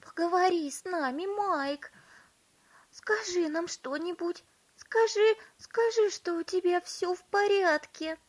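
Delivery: high, loud anxious mother; frantic pleading. A narrator's reading. Mother begging her son to speak, frantic. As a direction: beg urgently in a high voice.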